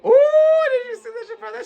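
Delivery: high, laughing hype; excited. Real, not acted. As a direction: laugh it up over the replay. Reacting to the replay.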